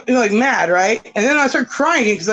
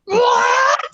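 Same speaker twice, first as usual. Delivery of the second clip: high shrieked what, much higher; shocked shriek; 13 semitones higher than usual. Retold or imitated. Mom hearing her son maced himself, shrieking. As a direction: shriek it in disbelief.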